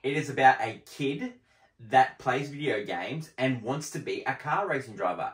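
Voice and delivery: ordinary talk, nothing marked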